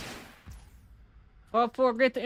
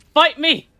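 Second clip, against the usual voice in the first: louder taunt; 3 semitones higher, 6 dB louder than usual. Acted. Daring the opponent across the board, louder taunt. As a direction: shout the dare with a grin.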